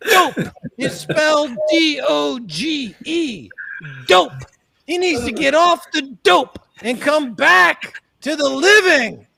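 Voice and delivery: shouted sermon, pitched high; mock preacher shout. Preacher shouting to the crowd about dope. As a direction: hammer the word like a pulpit.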